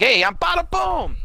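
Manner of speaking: very high, slow, drawn-out mock cheer; hammed-up high holler; showy announcement. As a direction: announce yourself with a big flourish. Voices returning character's greeting.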